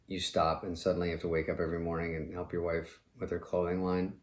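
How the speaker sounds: normal talking voice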